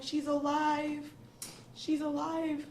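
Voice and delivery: high, slow, tearful relief; overcome, voice lifted high. Real, not acted. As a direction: say it through tears, drawn out and high. Tearful mother retells phoning her mother.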